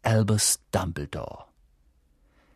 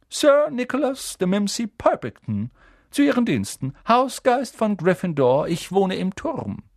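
high, loud genteel ghost; 9 semitones higher, 4 dB louder than usual. A narrator's reading. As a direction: speak as a polite old ghost. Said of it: "sagte der Geist". (hohe, vornehme Geisterstimme)

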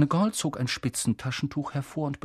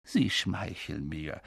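low, slow, softened voice; quiet; 6 semitones lower, 6 dB quieter, slower than usual. A narrator's reading. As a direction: say it softly, slowly and low. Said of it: "sagte Dumbledore leise". (leise, langsam und tief)

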